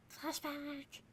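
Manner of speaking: high, echoing flashback cry; dramatic announcer. Acted. GM announcing a flashback to the players, dramatic. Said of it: "GM announces flashback dramatically".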